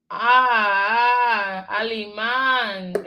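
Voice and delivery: slow, stretched "á" in alemán; emphasized A. Shown as a demonstration. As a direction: sound out a long "Ah", then alemán with a drawn-out "án". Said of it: "it's an emphasis on the word".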